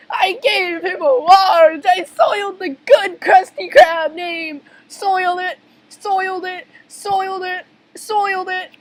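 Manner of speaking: high, wailing panic; shrieking guilt, repeating like a broken record; hysterical confession. Acted. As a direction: lament the mistake in a frantic squeal. SpongeBob frantic over his mistake.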